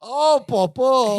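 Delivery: put-on slow, drawn-out foreign accented dismay; Balky realizing what just happened, slow and foreign-sounding. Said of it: "he turns and he goes".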